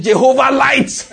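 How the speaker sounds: high, drawn-out cry of acclamation; retold excited shout. Excited brother calling out as power fails in prayer, imitated shout. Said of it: "The brother shouted".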